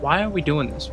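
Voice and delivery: ordinary talk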